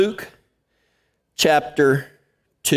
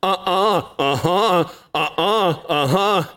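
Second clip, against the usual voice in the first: put-on toddler-style uh-uh uh-huh; mock toddler argument; childish back-and-forth; slower than usual. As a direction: bicker like two-year-olds. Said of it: "turned into a two-year-old conversation".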